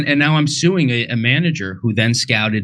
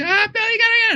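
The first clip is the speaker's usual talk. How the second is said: very high wife squawk; shrieky high-pitched spouse imitation; mock nagging wife; much faster than usual. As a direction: interrupt in a high put-on wife voice. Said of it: "And my wife comes in".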